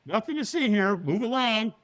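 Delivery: high-pitched, over-cheery cover-up; fake all's-well act. Nervous man lies to a concerned stranger, fake all's-well act. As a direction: brush them off as if nothing is wrong. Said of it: "we lie".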